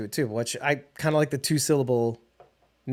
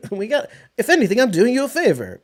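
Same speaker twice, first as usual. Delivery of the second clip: higher-pitched hammed-up politician voice; schlocky mayor; 7 semitones higher, 4 dB louder than usual. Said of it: "he doesn't sound like that at all".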